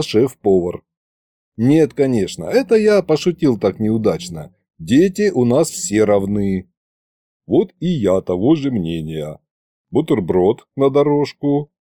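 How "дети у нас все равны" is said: usual speaking voice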